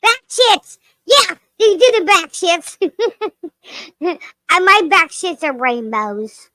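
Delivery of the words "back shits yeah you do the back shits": excited. Real, not acted. Gleeful joking outburst.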